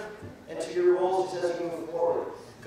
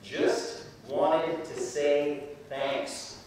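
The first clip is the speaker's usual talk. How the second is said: slow and even, each word laid out; slow, simple thanks; 3 semitones lower, 3 dB quieter, much slower than usual. Shown as a demonstration. Speaker showing students the message, slow and simple. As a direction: model the message slowly. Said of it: "feel free to just say the words".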